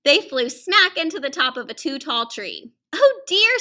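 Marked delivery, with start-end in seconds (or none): 2.9-3.6 Santa dismayed. Acted. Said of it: "said Santa".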